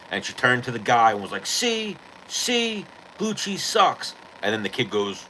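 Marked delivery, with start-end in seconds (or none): 1.5-4.1 put-on much higher, teasing bar girl voice; mock girl voice. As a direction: squeak it like a gloating girl at the bar.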